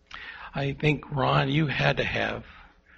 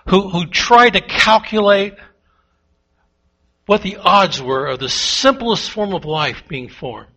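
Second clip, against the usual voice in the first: loud, driving sermon delivery; preaching at full force; 4 semitones higher, 8 dB louder than usual. Acted. Pastor preaching loudly to the congregation, full force. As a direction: hammer the point home to the congregation.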